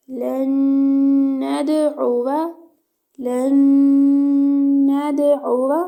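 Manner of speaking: नदउवा with the doubled नून held in a nasal hum; nasal ghunna on doubled noon. Shown as a demonstration. Teacher shows the ghunna on नदउवा to students. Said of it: "नून मुशद्दद है, गुन्ना होगा".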